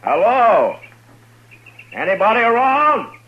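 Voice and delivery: loud, high call across an empty yard. Acted. Marshal calling out to an empty ranch, loud and high. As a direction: shout it out to find anyone home.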